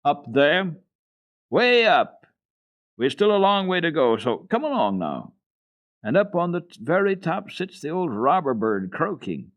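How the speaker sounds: put-on high, loud boy's voice pointing upward; boy calling out. A narrator's reading. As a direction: coax her on, calling.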